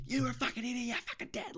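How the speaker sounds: high, strained mock fury; imitated road rage. Someone at the intersection turning on the speaker, high furious mock voice. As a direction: snap into a put-on, high-pitched threat. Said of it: "someone will go".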